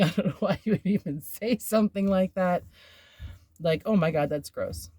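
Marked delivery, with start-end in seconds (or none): none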